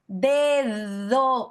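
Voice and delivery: DEDO drawn out, tongue between teeth on second d; exaggerated interdental d. Shown as a demonstration. Teacher exaggerates so students can see, interdental d. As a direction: stretch a buzzing th-like d inside DEDO. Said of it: "Estoy exagerando".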